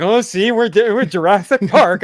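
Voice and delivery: hammed-up parody of a boastful franchise; mocking the movie. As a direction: sneer it like the filmmakers patting themselves on the back. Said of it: "You're really just gonna be like".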